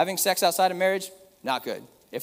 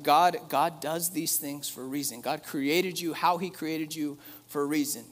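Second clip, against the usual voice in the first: ordinary talk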